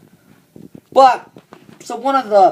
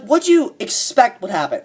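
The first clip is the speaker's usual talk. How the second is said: usual speaking voice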